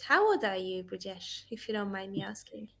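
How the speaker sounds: ordinary talk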